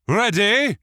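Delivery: huge high bellowed command. A narrator's reading. Captain of the guard bellowing ready to the soldiers. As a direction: bellow it across the hall. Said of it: "the captain of the guard bellowed".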